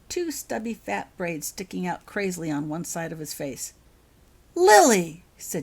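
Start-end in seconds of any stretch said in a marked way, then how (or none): 4.5-5.2 loud, high scolding call; mother calling out to her daughter; sharp call. Acted. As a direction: call the child back sharply.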